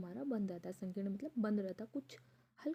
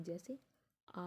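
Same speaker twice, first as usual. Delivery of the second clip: ordinary delivery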